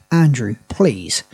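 ordinary talk

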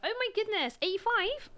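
high-pitched, badly faked Bristolian; Bristolian accent. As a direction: imitate a surprised Bristolian pharmacist. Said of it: "That's not a good accent".

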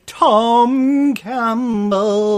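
slow, drawn-out big announcement; announcer intro. Acted. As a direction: stretch the name out like a ring announcer. Host introducing co-host grandly.

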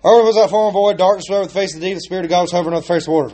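rushing through a familiar verse, very fast; rattled off. Retold or imitated. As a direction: race through it without thinking. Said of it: "we just run right through it".

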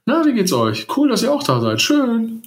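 high, sing-song welcome; cheery greeting. Pretend. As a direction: gush over the arrivals. Mock greeting newcomers.

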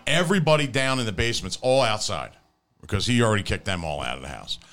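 ordinary delivery

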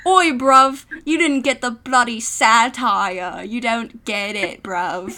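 loud, slow mock British bloke; exaggerated British accent, drawn out and forceful. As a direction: put on a hammed-up British accent, punching the opening. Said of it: "my, my British accent".

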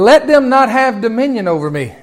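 loud, high pulpit prayer; preacher proclaiming the psalm; proclaimed prayer. Acted. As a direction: pray it out boldly.

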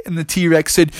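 usual speaking voice

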